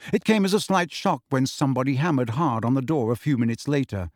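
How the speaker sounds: ordinary delivery, nothing marked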